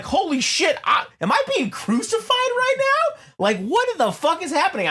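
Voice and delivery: squeaky disbelief, imitating himself; mock panic. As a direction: play up the shock of waking on a cross. Dream crucifixion, shocked panic.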